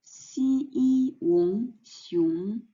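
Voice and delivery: "xi yi wong" drawn out slowly, then "xiong" rising; syllable-by-syllable pronunciation. Shown as a demonstration. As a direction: sound out "xi yi wong" and blend it into "xiong". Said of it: "We say in Chinese".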